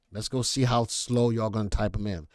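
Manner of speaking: ordinary talk, nothing marked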